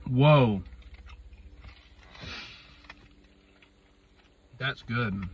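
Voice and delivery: chewing while talking; talking between bites; mouth full. Real, not acted. Seen 0: Closed-mouth chewing, eyes shut, before and during the early words.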